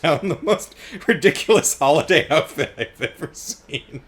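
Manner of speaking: laughing through the words, breaking up; amused, voice cracking into laughter. Real, not acted. Amused by the ridiculous outfit.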